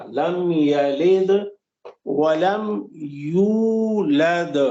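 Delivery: very slow لم یلد ولم یولد, each دال bounced; qalqala. Shown as a demonstration. Teacher demonstrating qalqala on each verse end. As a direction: let the final دال echo. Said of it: "قلقلہ".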